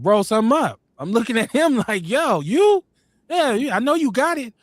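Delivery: ordinary talk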